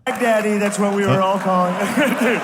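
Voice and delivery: lightly voiced Trump, mocking; a hint of Trump taunting; Trump impression. Clip replayed of comedian doing Trump, lightly voiced. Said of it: "That's a great Trump impression".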